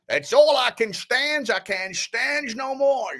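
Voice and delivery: high, drawn-out put-on character voice; cartoonish catchphrase voice. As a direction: stretch it out slowly in a high, silly impression.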